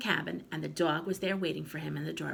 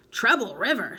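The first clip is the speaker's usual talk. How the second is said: louder, scornful repeat; disgusted; 5 semitones higher, 9 dB louder than usual. A narrator's reading. Grandma repeating the river's name with disgust. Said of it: "Her disgust was plain".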